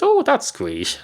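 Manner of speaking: high-pitched pleased exclamation; light up at the compliment, voice leaping up; delighted. Acted. Cat pleased at making a joke.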